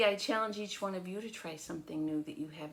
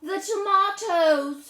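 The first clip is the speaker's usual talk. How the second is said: much higher, louder, slow with a cry; dramatic reveal; 13 semitones higher, 14 dB louder, slower than usual. A narrator's reading. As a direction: land the shock.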